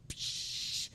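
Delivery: quick forceful unvoiced breath out; sharp exhale. Shown as a demonstration. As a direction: push the air out like a bench press. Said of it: "when you're doing the bench press and you go".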